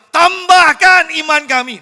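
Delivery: high, loud and slow cry; shouted unison plea. Shown as a demonstration. Preacher showing the disciples crying out together, loud slow shout. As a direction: shout it all at once like a crowd. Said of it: "Ini serempak".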